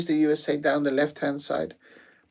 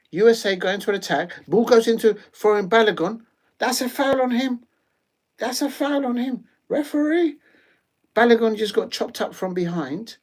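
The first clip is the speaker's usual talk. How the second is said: higher, louder commentator call; live play-by-play; 8 semitones higher, 4 dB louder than usual. Acted. Streamer calling the USA attack for viewers, commentator style.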